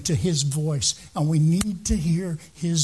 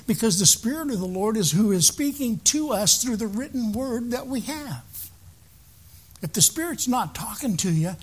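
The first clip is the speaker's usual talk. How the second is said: normal talking voice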